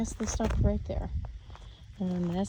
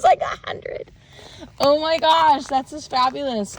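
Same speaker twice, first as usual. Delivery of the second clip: thrilled; 7 semitones higher, 6 dB louder, slower than usual. Real, not acted. Thrilled by dense seedling rows.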